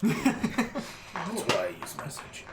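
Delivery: whispered, slow and unvoiced. Real, not acted. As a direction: breathe it out slowly. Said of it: "It's so terrifying".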